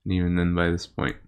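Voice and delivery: game master narration. Acted.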